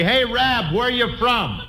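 high mock call to a rabbit; sarcastic hailing. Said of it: "walk up to him and say".

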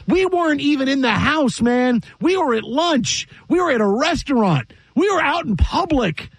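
high-pitched, emphatic protest; indignant disbelief. Real, not acted. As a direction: hammer each place home, incredulous. Indignant list of where they were.

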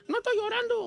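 put-on high, whiny little kid insisting; childish denial. Boy denies crying to fairy godmother, high childish whine. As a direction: deny it like a sulky child. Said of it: "Era como los chiquillos".

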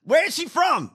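high-pitched, loud disbelief; exasperated outburst. Real, not acted. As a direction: cry out in comic outrage. Exasperated reaction to played clip.

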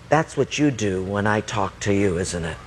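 low, measured, knowing reproof; God speaking. Acted. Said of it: "then God spoke to me".

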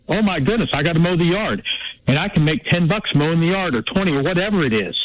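normal talking voice

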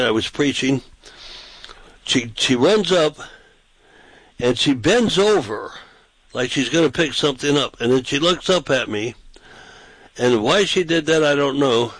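ordinary delivery

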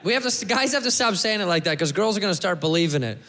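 ordinary talk

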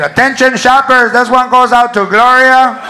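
put-on loud, slow intercom dedication in a Chicano accent; Chicano dedication shout-out. The announcer dedicates a song to Gloria over the intercom, Chicano accent. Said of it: "they do shout-outs".

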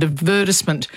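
"advertisement" stressed on VER, ad-VER-tis-ment; recommended older pronunciation. Shown as a demonstration. Linguist naming the recommended one, ad-VER-tisment. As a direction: stress second syllable of "advertisement", short "tis". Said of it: "given a spelling pronunciation".

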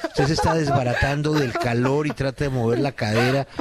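ordinary delivery

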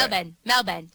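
Melbourne as the locals say it, no R; Australian Melbourne. Shown as a demonstration. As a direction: say Melbourne with no R, like "Mel-bn". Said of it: "they don't pronounce the R".

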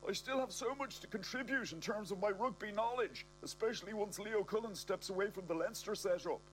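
high frantic pleading; panicked shout. Acted. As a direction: yell it while fleeing. Fleeing bomb scare.

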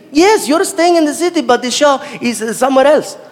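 put-on emphatic Saudi-accented English; accented driver. Driver to visiting comic about the show, heavily accented.

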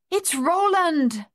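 high, drawn-out exclamation; joyful cry. A narrator's reading. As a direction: cry out the news, slow and high. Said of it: "Madame Chessy cried".

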